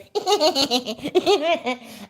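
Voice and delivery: high mocking cackle imitation; taking the piss out of a creepy laugh; imitated laugh. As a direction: mimic his creepy laugh. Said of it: "Because he goes".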